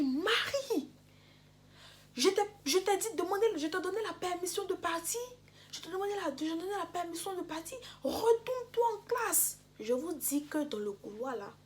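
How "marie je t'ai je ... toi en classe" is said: high, loud scolding shout at a student; teacher yelling. Retold or imitated. Teacher shouting at the student in the hallway, loud and high. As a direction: yell at her down the hallway. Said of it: "elle se retourne et elle crie".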